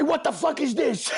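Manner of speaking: high angry yell at the counter guy; mock furious shout; retold yell. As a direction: demand it, outraged. Yelling at deli guy.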